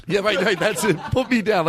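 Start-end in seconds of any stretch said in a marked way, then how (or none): 1.1-1.7 high, laughing mimicry; playful mock protest. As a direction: squeal it lightly, as if having too much fun. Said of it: "that's how much fun you're having".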